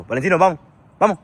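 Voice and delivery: loud call to a wandering dog. Real, not acted. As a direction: call him back firmly. Calling the dog.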